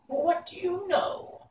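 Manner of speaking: high, slow cackling-witch question; old witch voice. Acted. Witch Mombi sizing up the man she made, high and slow. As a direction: peer at him like a suspicious old witch.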